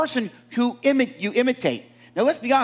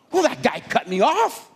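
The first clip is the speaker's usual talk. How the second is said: mock-indignant, higher-pitched protest; indignant driver; 4 semitones higher than usual. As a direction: complain like a son caught out. Acted indignant driver answering Father.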